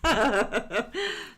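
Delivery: loud high laugh at the memory; real amused laughter; burst of laughter. Real, not acted. Laughing at dad story.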